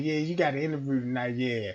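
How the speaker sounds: low, quiet, fast brush-off; cancelling call. Retold or imitated. Man cancelling the interview date on the phone, low fast brush-off.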